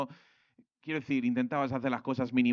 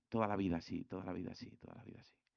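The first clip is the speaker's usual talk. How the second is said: each repeat softer until a whisper; fading to a whisper; 5 semitones lower, 9 dB quieter, faster than usual. Real, not acted. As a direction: let the phrase die away into a whisper. Repeats phrase, fading away. (se apaga hasta susurrar)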